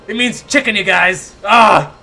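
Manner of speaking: high, loud exclamation ending in a yell; excited outburst. Real, not acted. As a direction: announce it loudly to everyone. Exclaims the silly meaning to chat.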